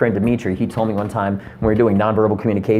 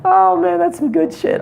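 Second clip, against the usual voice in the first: laughing. Real, not acted.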